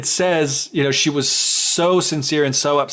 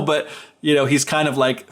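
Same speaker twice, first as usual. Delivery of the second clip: ordinary delivery, nothing marked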